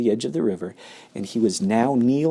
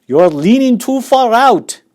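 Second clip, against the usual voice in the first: shouting; 13 semitones higher, 9 dB louder than usual. Acted. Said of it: "shouted Mister Gloop".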